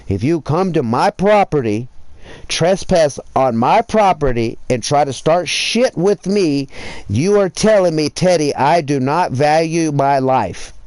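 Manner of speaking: rising threat to anyone who comes onto his property; building threat. Real, not acted. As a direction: warn them off harder with every line.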